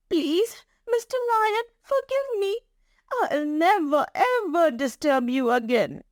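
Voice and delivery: very high, begging little mouse voice; frightened pleading mouse. A narrator's reading. Mouse begging the angry lion for mercy, pleading. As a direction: beg for forgiveness in a high squeak. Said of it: "is afraid and starts trembling".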